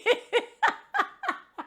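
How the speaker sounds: high, hearty cackle of laughter. Real, not acted. Storyteller cracking up at her own story, high laughter. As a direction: burst out laughing at your own boast.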